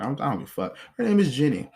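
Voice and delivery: ordinary talk, nothing marked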